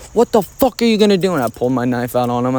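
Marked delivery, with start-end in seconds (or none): 0.1-1.3 higher, heated dare thrown at him; retold challenge. Retold to viewers, a higher, heated challenge to the uncle. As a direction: square up to the uncle who grabbed you. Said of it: "I was like".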